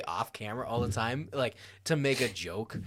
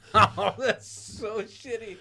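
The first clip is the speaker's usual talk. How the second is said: laughing disbelief; 11 semitones higher, 5 dB louder, much slower than usual. Real, not acted. Laughs at the story.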